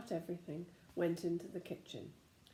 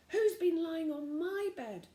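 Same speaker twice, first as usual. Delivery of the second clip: mother bear voice; 9 semitones higher, 5 dB louder than usual. A narrator's reading.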